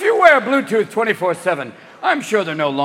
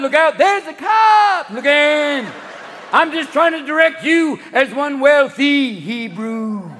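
[name] impression; 9 semitones higher, 6 dB louder than usual. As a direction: ramble the directions like [name]. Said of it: "[name] is gonna be making".

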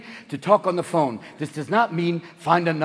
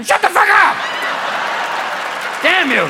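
high, loud furious yell; angry shout; 11 semitones higher, 4 dB louder than usual. Retold or imitated. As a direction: scream at the car. Yelling back at the car.

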